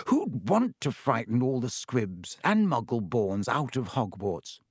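ordinary delivery

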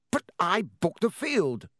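spitting with rage. A narrator's reading. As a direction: bite out each word in rage. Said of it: "positively spitting with rage".

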